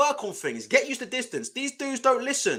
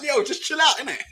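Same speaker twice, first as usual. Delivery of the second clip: calming; 4 semitones higher than usual. Retold or imitated. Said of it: "I'm like".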